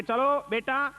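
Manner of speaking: retold call upward, hurrying the kids, pitched high. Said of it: "ઉપરથી બૂમ મારી". (ઉપર બાળકોને ઊંચા અવાજે બૂમ)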